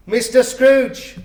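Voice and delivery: lifting his glass, proudly naming Mr Scrooge; proud toast. A narrator's reading. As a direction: announce the name grandly to the table. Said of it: "said Bob".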